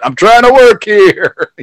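mock exasperated shout; high, drawn-out loud complaint; hammed-up yell of a fed-up worker; Brett. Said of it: "And Brett".

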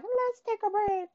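very high, sing-song mimicry; playful self-quote. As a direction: squeak out your own earlier sign-off. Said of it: "as I was saying before".

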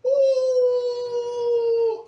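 piercing high loud shriek; sudden high scream. Retold or imitated. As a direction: let out a huge outraged scream. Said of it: "I yelled my fucking ass off".

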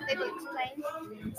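ordinary delivery

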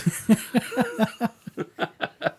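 bursts out in high laughter; a real giggle at the joke. Real, not acted.

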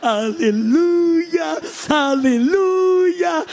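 hammed-up booming church greeter; mock big preacher. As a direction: stretch every word like a showy pulpit welcome. Mimicking a loud slow preacher.

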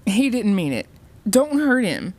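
high, urgent pleading; terrified. A narrator's reading. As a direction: beg for his safety, rising in alarm. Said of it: "who wore a terrified expression".

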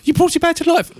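high, awed voice of wonder; amazed. Retold or imitated. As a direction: gasp it out in amazement. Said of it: "and they're like".

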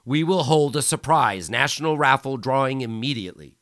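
loud, high triumphant proclamation; bellowing. Acted. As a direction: proclaim it over the crowd. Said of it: "Micheletti bellowed".

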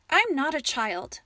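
mild indignant snap; snapping. A narrator's reading. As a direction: bite back at him, offended. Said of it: "Ava snapped".

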